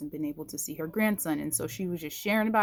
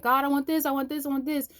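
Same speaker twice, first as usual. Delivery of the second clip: higher, louder pleading whine; whiny begging; 8 semitones higher, 3 dB louder, faster than usual. Retold or imitated. Believer whining at God for things, mock whine. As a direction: beg like a spoiled child.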